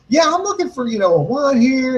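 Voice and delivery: high-pitched imitation of a friend; put-on customer voice. Friend asks the store woman for wine, imitated high voice.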